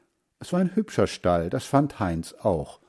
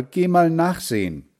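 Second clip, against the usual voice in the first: raised, higher storybook call; 8 semitones higher, 4 dB louder than usual. A narrator's reading. Mother sends her son to check the door, calling out. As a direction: send someone off with a call. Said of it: "rief Mutter".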